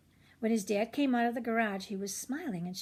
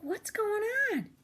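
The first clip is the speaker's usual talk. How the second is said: put-on much higher, bewildered kid voice; confused boy; 12 semitones higher than usual. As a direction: blurt it out totally lost. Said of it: "He's like".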